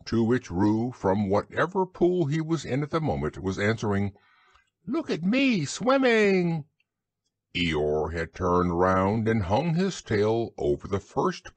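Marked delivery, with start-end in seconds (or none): 4.9-6.6 high, drawn-out proud squeal; squeaky Roo. A narrator's reading. Roo answers Kanga from the pool in a proud squeak. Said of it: "squeaked Roo".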